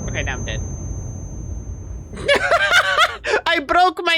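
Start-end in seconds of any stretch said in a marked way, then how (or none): 0.7-3.4 high breathy laugh that falls away; real laugh tumbling down; burst of laughter. Real, not acted. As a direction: laugh out loud at your own crash. Laughs after dying in game.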